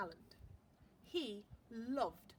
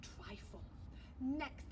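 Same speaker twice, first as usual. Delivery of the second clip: usual speaking voice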